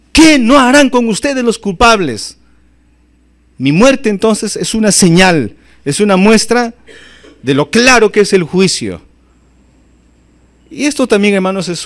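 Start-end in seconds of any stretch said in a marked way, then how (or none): 0.1-9.0 stern warning. Acted. Preacher voices Jesus on the guilty.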